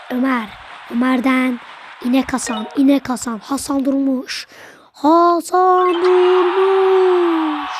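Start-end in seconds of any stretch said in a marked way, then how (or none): 4.9-7.7 slow, stretched-out name call; long stretched football name call; drawn-out commentator call. Acted. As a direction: draw the name out like a TV announcer.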